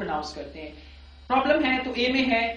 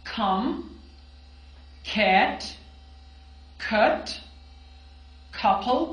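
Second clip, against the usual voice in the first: clear drill words; much slower than usual. Shown as a demonstration. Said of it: "मेरे साथ repeat कीजिएगा".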